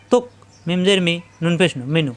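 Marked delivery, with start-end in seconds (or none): 0.1-0.2 তুক cut off in the throat with no bounce; caught glottal stop. Shown as a demonstration. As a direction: catch the end of তুক in the throat, don't release it. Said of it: "কলকলা হবে না". (তুক গলায় আটকে, কলকলা ছাড়া)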